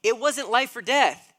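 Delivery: husband venting at wife's delay, exasperated and loud; exasperated outburst. Retold or imitated. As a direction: vent at her disbelieving. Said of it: "it's like".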